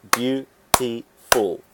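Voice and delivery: slow, syllable by syllable; clapped syllables. Shown as a demonstration. Teacher claps out the syllables of beautiful. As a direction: beat out each syllable slowly. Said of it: "the clap test".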